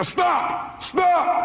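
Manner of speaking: high-pitched shouted command; retold yelling. Counselor interrupting a caller on the radio, high yell. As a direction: re-enact cutting someone off with a yell. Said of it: "I started yelling on the phone".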